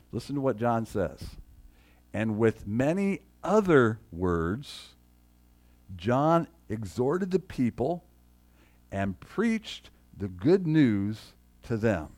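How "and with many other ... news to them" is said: slow, weighty key verse; slow emphatic reading. Shown as a demonstration. Pastor stressing the key verse for the congregation, slow and weighty. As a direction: let every word of the verse land. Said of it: "Now here's the key right here".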